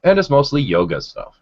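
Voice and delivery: normal talking voice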